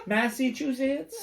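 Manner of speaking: laughing, high questioning Massachusetts?; mock local saying Massachusetts? with a giggle. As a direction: squeak out Massachusetts? through a laugh. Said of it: "You're like".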